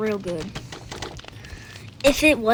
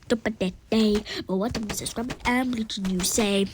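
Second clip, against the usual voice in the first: ordinary talk, nothing marked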